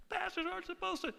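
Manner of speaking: high, small objecting voice; mock church member. Church member protesting to the pastor, high mock voice.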